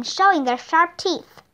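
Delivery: ordinary delivery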